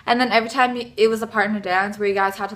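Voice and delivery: normal talking voice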